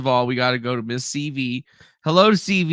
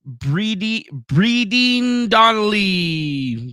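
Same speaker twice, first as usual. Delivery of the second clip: drawn-out, higher name announcement; botched name attempt; 5 semitones higher, 3 dB louder, much slower than usual. Real, not acted. Streamer fumbling a viewer's name, drawn-out attempt. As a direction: stretch the name out slowly and grandly. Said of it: "Yeah, I fucked that up".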